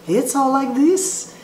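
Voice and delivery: lightly mock operatic tone; imitating a classical singer. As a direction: sound like an opera singer doing jazz. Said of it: "a classical singer singing jazz".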